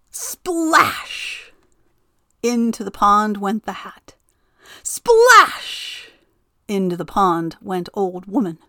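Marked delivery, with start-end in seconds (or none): 0.1-1.5 splash. A narrator's reading. Narrator voices the splash. 4.8-6.1 splash. A narrator's reading. Narrator voices the splash.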